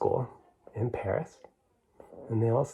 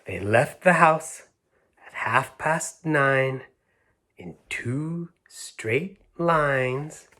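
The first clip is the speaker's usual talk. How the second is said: slow, drawn-out rhyming read-aloud; storybook reading; 4 semitones higher, much slower than usual. A narrator's reading. Storyteller reads the rhyme to children slowly. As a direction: stretch the last line out for the children.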